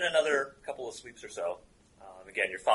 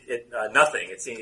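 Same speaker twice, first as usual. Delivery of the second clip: ordinary talk, nothing marked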